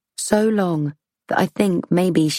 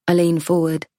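ordinary delivery, nothing marked

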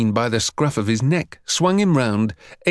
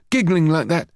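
put-on higher-pitched boy's retort; protesting; 5 semitones higher than usual. A narrator's reading. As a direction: throw the blame back, a little higher. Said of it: "Harry protested".

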